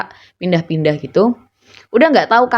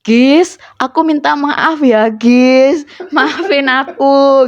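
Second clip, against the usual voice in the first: high, drawn-out mock pleading; imitated apology; 7 semitones higher, 4 dB louder, much slower than usual. Friend begging the group's forgiveness, playfully pleading. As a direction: stretch out a hammed-up, higher-pitched sorry.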